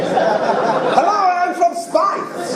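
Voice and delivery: high, slow alien drawl from space; space accent. Acted. Game master voicing space grapes in a high slow space accent. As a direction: stretch it out like a visitor from space. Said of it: "they have a space accent".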